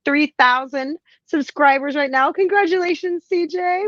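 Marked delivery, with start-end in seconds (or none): 2.3-3.8 high, happy congratulations; celebratory cheer. Real, not acted. Host congratulates a friend on subscribers, high celebratory cheer. Congratulating a fellow channel owner. 3.8-3.9 long high "woo"; celebratory whoop. Real, not acted. Host cheers a friend's milestone, long whoop.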